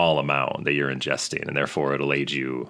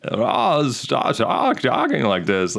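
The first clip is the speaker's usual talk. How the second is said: higher-pitched stammering old film-star impression; Jimmy Stewart; 7 semitones higher, slower than usual. Shown as a demonstration. Film fan showing how Stewart spoke on camera, stammering impression. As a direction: imitate a halting old movie star. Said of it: "like, Jimmy Stewart didn't really".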